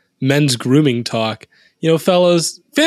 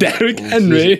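bad British accent; 6 semitones higher than usual. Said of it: "Is this badly as possible?".

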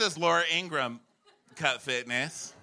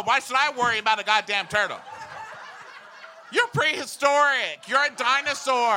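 high-pitched, hammed-up indignation; comic outraged rant; 8 semitones higher, 4 dB louder than usual. Comic blaming turtles for losing straws, outraged rant. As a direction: rail against it with mounting mock fury.

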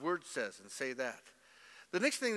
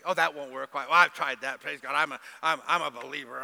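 mock believer; 9 dB louder than usual. Pastor mocking negative Christians, louder and sneering. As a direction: sneer it like a smug church critic.

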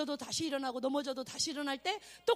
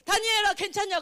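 lightly voiced king, loud and higher; 6 semitones higher, 9 dB louder than usual. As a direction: call out anxiously as the king. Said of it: "왕이 와갖고". (왕처럼 크고 높게 부르기)

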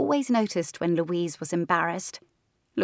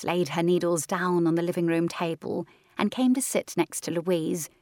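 plain reading voice

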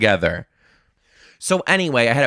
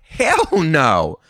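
emphatic refusal; 6 semitones higher, 3 dB louder, much slower than usual. Retold or imitated. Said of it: "I was also like".

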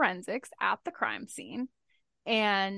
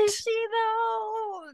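dramatic doubtful question; 12 semitones higher, much slower than usual. Real, not acted.

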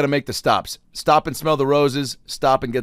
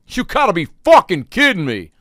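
high, loud exasperated outburst; angry customer; 9 semitones higher, 7 dB louder than usual. Retold or imitated. Angry customer in line at the Dairy Queen, high and loud. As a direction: blurt it like a fed-up customer. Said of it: "I hear a guy in front of me say".

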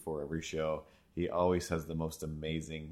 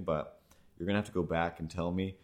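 ordinary talk

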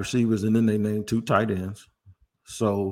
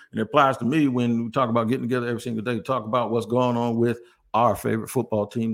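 usual speaking voice

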